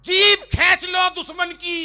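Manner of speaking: shouted high, hammed-up patriotic roar; over-the-top mock war-poet shout; mock veer ras poet. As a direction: bellow it like a fiery stage poet. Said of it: "वीर रस के कवि होते हैं".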